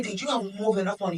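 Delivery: ordinary talk, nothing marked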